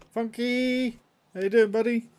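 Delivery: high cartoon voice. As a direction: greet like a goofy cartoon character. Greeting in put-on voice.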